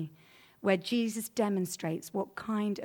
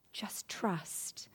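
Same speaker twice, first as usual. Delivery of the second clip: whispered; 8 dB quieter than usual. Acted. Gentle appeal to the listeners.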